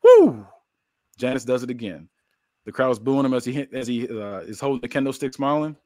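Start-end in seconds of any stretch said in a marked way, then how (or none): 0.0-0.5 high, loud whoop; loud "woo". Acted. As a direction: let out a short high woo. Narrator voices moment of the blow.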